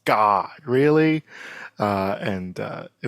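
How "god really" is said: imitating amazed audience. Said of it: "People were like".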